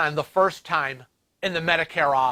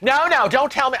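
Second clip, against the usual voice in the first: 1 retold protest; 8 semitones higher, 5 dB louder than usual. Arguing with rude phone agent.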